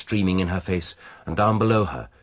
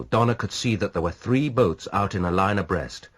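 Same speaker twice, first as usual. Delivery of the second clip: ordinary delivery, nothing marked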